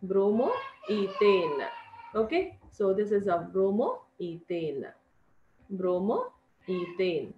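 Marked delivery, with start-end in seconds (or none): 0.1-1.7 drawn-out, syllable by syllable; slow pronunciation. Shown as a demonstration. Teacher to children, pronouncing the compound name very slowly. 3.5-7.3 drawn-out, syllable by syllable; slow pronunciation. Shown as a demonstration. Teacher to children, pronouncing the compound name very slowly.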